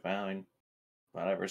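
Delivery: ordinary delivery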